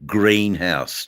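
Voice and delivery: usual speaking voice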